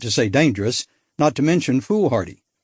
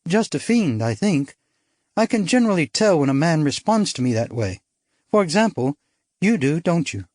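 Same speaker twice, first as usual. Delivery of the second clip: put-on lighter, higher bright young woman; spirited society girl, higher; young woman character; 4 semitones higher than usual. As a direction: play her as a bold upper-class girl. Narrator voices young woman.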